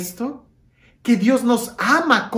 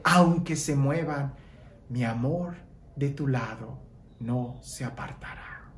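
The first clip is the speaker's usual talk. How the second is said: much softer and lower, almost whispered; a tender near-whisper, lower and softer; hushed scripture; 7 semitones lower, 10 dB quieter than usual. Acted. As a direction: murmur God's promise softly. Quiet scripture quote.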